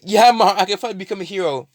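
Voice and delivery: high, fast fake enthusiasm; mock excited. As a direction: gush with fake joy. Deku fakes excitement to mother.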